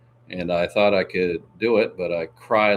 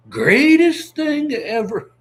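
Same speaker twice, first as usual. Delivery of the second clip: much higher, slow drawn-out proclamation; mock-grand repeat; 7 dB louder, slower than usual. Echoing the boast to viewers, hammed-up and slow. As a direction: stretch it out like a braggart.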